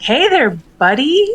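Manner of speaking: high, bright "hey there buddy" to a wild animal; sweet excited greeting. Pretend. Player greets the walrus sweetly, high and eager. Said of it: "very calmly, very sweetly, a little bit excited".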